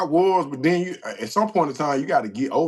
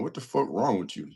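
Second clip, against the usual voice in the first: ordinary delivery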